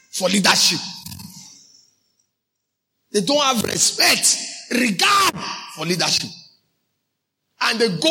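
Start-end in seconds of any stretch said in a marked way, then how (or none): 3.1-5.3 slow, climbing to a high cry on regard; rising emphasis. Real, not acted. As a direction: build to regard and hit it. Preacher building emphasis.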